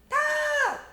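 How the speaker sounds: very high loud shout. Acted. Mama yelling talk up the stairs, very high. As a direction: yell each word up the stairs.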